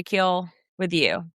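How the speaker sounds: ordinary talk, nothing marked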